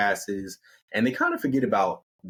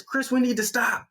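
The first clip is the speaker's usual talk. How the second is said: high-pitched plea to stop; complaining halt; 13 semitones higher than usual. Acted. Sweating Amy calls to Chris, complaining.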